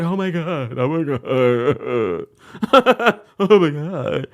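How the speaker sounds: hammed-up sobbing 'oh my God'; mock-wail, whimpering through the words; imitated crying. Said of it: "She starts crying, too. She's like".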